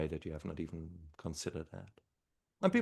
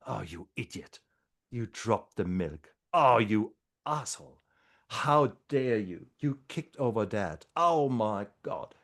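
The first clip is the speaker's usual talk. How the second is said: mock self-scolding; 3 semitones higher, 5 dB louder than usual. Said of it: "how often do you flagellate yourself".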